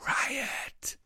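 high, whispery ghostly wail; wailing shadow. A narrator's reading. Shadow raises the alarm, wailing. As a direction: wail it like a wraith. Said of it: "one of the shadows wailed".

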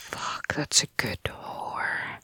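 very soft, slow whisper; whispered praise. Acted. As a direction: draw it out under your breath. Intimate praise in roleplay.

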